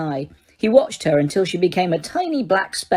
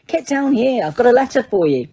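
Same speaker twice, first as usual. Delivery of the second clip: calling up; 5 semitones higher than usual. A narrator's reading. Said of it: "he said, spotting her".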